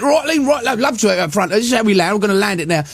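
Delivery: put-on cheery high Essex accent; chirpy Essex bloke showing off the landing; Essex pilot. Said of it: "he was from Essex".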